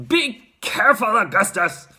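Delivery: urgent, high call; Mister Gloop. A narrator's reading. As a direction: shout a father's warning. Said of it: "shouted Mister Gloop".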